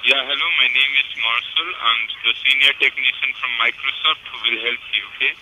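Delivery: Indian English tech support voice; indian call center. Real, not acted. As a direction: Indian accent, formal phone support. Senior technician scammer on phone.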